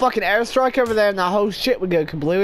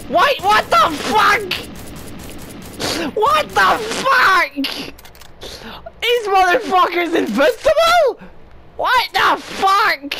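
stunned outrage; 8 semitones higher than usual. Real, not acted. Gamer yelling at the game when teammates won't die, shocked shout. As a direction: yell in shocked disbelief. Bullets don't kill teammates.

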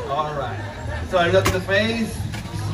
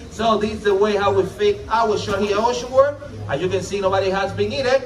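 announcer; 5 semitones higher, 5 dB louder than usual. Acted.

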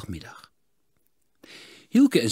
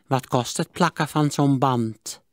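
put-on miserly old voice, a bit higher; stingy old man; 5 semitones higher, 4 dB louder, slower than usual. A narrator's reading. Miser haggles with the smith, stingy old voice. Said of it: "wilde Sigertsma weten".

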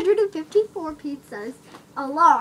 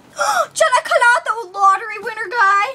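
shocked gasp; 6 semitones higher than usual. Pretend. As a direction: squeal in disbelief at who's calling. Gasping at a famous caller.

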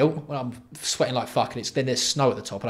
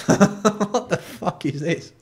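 high, slow, laughing; higher laughing disbelief; 6 semitones higher, much slower than usual. Real, not acted. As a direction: laugh it out, baffled.

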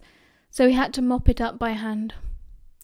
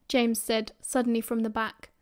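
ordinary delivery, nothing marked

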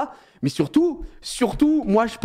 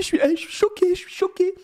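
high, strained sobbing imitation; husband imitating his weeping wife, a high mock sob; mock crying wife; 14 semitones higher than usual. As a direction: whimper it like a teary wife.

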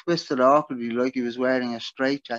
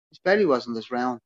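ordinary delivery, nothing marked

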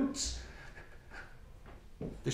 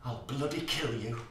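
ordinary talk

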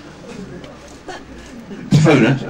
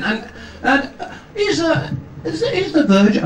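high, halting mimicry of a New Zealand interviewer; New Zealand accent. Interviewer hesitantly asks after the Verger, imitated New Zealand accent. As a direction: stumble through it high-pitched in an attempted New Zealand accent. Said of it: "whether I can do the accent".

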